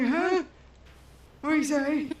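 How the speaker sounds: put-on half-deaf old man asking you to repeat; old person voice. Showing off her old people voice, a hint of a deaf elder. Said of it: "my old people voices are like".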